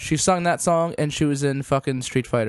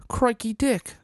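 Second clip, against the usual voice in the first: higher-pitched mock Australian; bad Australian accent; 7 semitones higher, 3 dB quieter, slower than usual. Joking after Australia comes up, bad Australian accent. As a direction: put on a hammy Australian accent. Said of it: "worst Australian accent ever".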